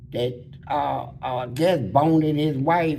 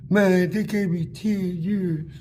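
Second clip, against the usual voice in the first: higher, slow and sorry-sounding; a lightly voiced whiny new inmate; new inmate's voice; 5 semitones higher, slower than usual. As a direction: play the new guy moaning about his years.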